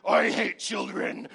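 slow, cold, nasty teacher voice; hammed-up teacher revealing her contempt; mock mean teacher. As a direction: drop the sweetness and sneer slowly. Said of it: "She'd be like".

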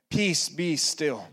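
slow, soft, hushed rebuke of the storm; calm command. Acted. Pastor to anxious listeners, slow soft calming command. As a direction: speak peace over the listener slowly.

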